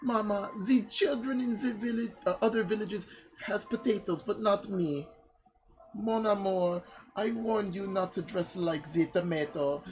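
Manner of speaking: high, mock-tearful French accent; lamenting French villagers. Villagers mourning eaten vegetables, high mock-tragic French accent. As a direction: wail in a hammed-up French accent.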